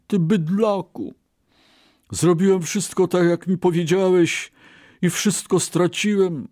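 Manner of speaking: high-pitched, aggrieved reproach; bitter outcry. A narrator's reading. Ruined Brahmin blaming his ox, crying out bitterly. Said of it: "zawołał pełen goryczy".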